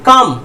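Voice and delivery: "कम" with a short "uh" vowel. Shown as a demonstration. Teacher showing students the O in "कम", short "uh" sound. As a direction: say "कम" as one firm "kum". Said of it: "इसको अ बोलिए तो क्या हो जाएगा".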